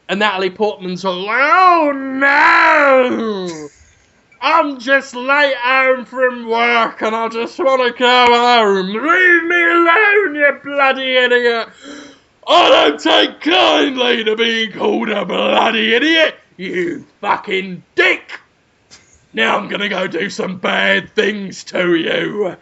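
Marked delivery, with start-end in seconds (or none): 1.2-11.7 hammed-up scared plea, high; mock frightened cry. Natalie Portman pleading, scared. 12.5-22.6 mock angry shout. Policeman shouting back.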